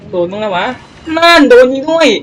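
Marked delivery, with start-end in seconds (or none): 1.2-2.1 higher and louder, a sudden yell; excited shout. Real, not acted. Gamer to viewers as his ship gets hit, excited yell. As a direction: yelp it out as the shot lands.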